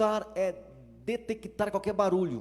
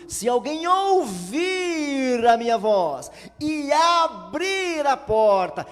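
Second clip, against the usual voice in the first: slow proclaiming; 8 semitones higher, 9 dB louder, slower than usual. Acted. Climactic verse proclamation.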